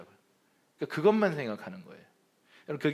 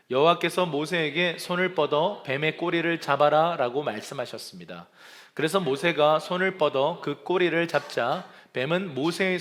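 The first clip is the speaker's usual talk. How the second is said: louder, measured Bible reading; scripture read aloud; 4 dB louder than usual. Real, not acted. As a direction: read the verses out with the congregation. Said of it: "큰 목소리로 읽겠습니다". (회중과 함께 크게 성경 봉독)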